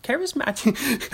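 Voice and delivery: high-pitched mock Cockney; Cockney accent. Shown as a demonstration. Host teasing a Cockney impression, high mock Cockney. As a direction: put on a squeaky Cockney. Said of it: "I was about to do a Cockney".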